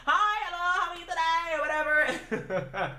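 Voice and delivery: high-pitched, exaggerated hostess greeting; Chinese lady impression. Chinese lady greets arriving diners, imitated high and perky. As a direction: imitate her in a high singsong. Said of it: "That's exactly how she sounded".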